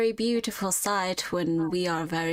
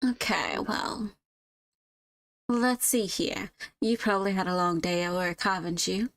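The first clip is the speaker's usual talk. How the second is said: easy small talk with a hint of southern twang; light southern twang; 3 semitones higher than usual. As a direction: florist chatting with a tired customer, light southern twang. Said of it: "slightly giving a southern twang to her".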